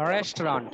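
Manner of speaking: "রেস্টুরেন্ট" low, in two syllables, rest-ront; English pronunciation. Shown as a demonstration. Teacher confirming two syllables, "রেস্টুরেন্ট" as low rest-ront. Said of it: "দুইটা syllable".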